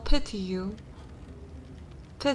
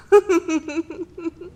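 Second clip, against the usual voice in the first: high, rippling villainess laugh; mocking laugh; 10 semitones higher, 5 dB louder than usual. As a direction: laugh scornfully at a begging creature. Mocking the begging creature.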